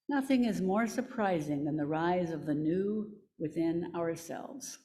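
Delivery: ordinary talk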